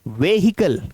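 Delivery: व्हीकल said "ve-hi-kal" with sounded H; wrong pronunciation. Shown as a demonstration. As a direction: give व्हीकल three syllables with an audible H. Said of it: "गलत है".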